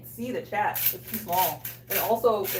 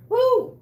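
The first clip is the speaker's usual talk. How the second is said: one high, loud whoop; high-pitched whoop of reaction; 11 dB louder than usual. Real, not acted. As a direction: let out a high "woo". Reacting to rich food.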